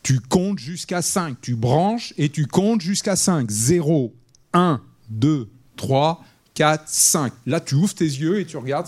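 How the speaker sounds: slow, deliberate counting for technicians; slow instructing. Retold or imitated. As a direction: spell it out to the tech crew, counting each beat. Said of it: "je disais aux techniciens".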